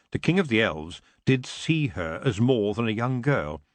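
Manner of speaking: usual speaking voice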